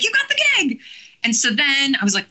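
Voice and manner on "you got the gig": high-pitched excited squeal, retold; excited good news. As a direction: imitate someone breaking thrilling news. Retelling the agency's exciting news.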